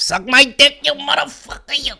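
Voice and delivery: high, rough mock mobster snarl; Joe Pesci impression. Pesci yelling at Sharon Stone, as a high mock mobster. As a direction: growl it at Sharon Stone like a movie gangster. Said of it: "him just forcing Sharon Stone's face".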